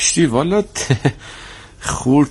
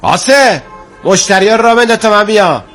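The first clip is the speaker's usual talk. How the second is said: calling out; 12 semitones higher, 5 dB louder than usual. Acted. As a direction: call loudly across the shop. Calling out to shop assistant. (صدا زدن بلند)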